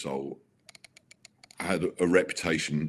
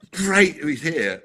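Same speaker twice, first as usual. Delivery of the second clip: eager hosts; 11 semitones higher, 3 dB louder, slower than usual. Retold or imitated. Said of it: "And then they go".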